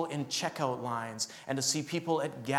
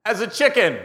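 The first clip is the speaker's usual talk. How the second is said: much louder, higher and slow, dramatic; hammed-up pulpit proclamation; booming preacher line; 10 semitones higher, 14 dB louder than usual. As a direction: proclaim it to shock the crowd. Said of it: "best opening line for preaching".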